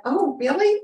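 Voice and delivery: high, teasing mock surprise; playful Reagan look put into words; twinkly oh really. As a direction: tease her with a bright oh really. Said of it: "he's looking at me like".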